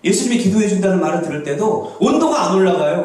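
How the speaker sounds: ordinary delivery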